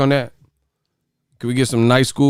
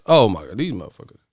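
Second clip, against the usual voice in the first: normal talking voice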